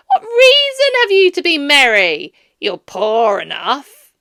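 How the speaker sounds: loud, high, contemptuous scolding; Scrooge scolding. A narrator's reading. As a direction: sneer at the poor nephew.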